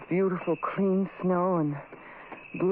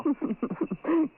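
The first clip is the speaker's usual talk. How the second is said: short laugh; 4 semitones higher than usual. Acted. Friend laughs at joke.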